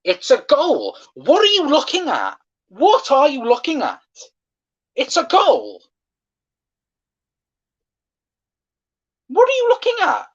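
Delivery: high, strained, disbelieving yell; outraged yelling. Real, not acted. Fan yelling at VAR over the disallowed goal, outraged high yell.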